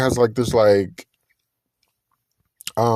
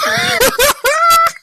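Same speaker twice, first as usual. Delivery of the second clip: loud high shriek of laughter; 5 dB louder than usual. Real, not acted. Vlogger cracking up at the nugget line, shrieking laugh. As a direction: burst out in a shrieking cackle.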